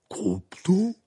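high, loud, puzzled question; disconcerted. A narrator's reading. Hagrid hears Croupton named, disconcerted. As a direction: repeat the name in bafflement. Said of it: "dit Hagrid déconcerté".

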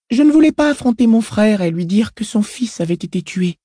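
put-on high little girl's voice; child voice. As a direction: speak as an uncanny, self-assured girl. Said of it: "dit la fillette".

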